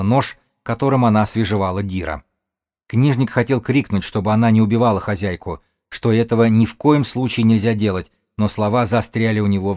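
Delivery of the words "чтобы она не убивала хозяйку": ordinary delivery, nothing marked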